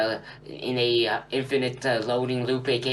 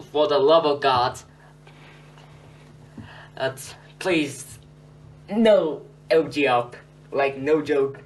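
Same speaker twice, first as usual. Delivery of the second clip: emphatic, raised-voice begging; exasperated plea; 3 semitones higher, 4 dB louder than usual. Real, not acted. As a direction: plead with viewers, punching each phrase. Begging viewers not to update.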